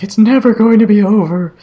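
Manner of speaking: hammed-up horror heroine line; ominous Jody. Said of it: "and she's like".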